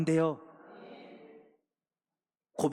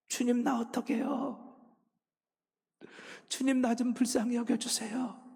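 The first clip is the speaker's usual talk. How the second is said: higher, pleading cry of prayer; desperate prayer, pitched up and pleading; crying out to God; 8 semitones higher than usual. Retold or imitated. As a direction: cry out for mercy, voice lifted. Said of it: "눈물이 터져 나오기 시작해요".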